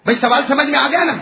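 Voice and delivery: normal talking voice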